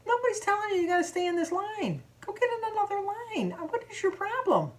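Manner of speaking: high-pitched, irritated telling-off; indignant retort. Retold or imitated. Shopper tells impatient customer to switch lines, indignant. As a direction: act out snapping back at a stranger. Acted quarrel in checkout line.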